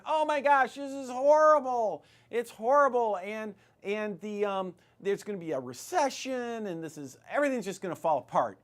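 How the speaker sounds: high, loud hammed-up panic; mock alarm. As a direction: wail like a doom-saying economist. Voicing economists' panicked predictions.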